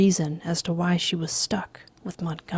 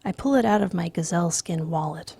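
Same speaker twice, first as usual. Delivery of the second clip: usual speaking voice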